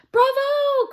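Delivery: very high, loud cheer; bright triumphant cry. Acted. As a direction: princess cheers the hit. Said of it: "cried the princess".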